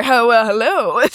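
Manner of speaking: lightly mocking a try-hard recording voice; trying-too-hard host. Daughter mimicking dad turning on the recorder, hammed-up effort. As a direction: imitate dad putting it on for the recorder. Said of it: "he tries so hard".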